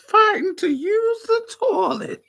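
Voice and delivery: slow, very high wailing granny; old lady wail. Pretend. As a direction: cry it like a cranky granny. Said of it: "I wanna be talking shit".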